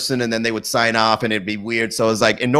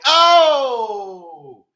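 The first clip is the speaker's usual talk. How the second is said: a much higher, loud whooping "Oh!"; hype man shout; 9 semitones higher, 4 dB louder than usual. Retold or imitated. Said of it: "he says, "Oh," for me".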